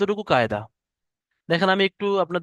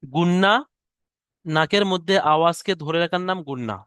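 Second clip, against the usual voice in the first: ordinary talk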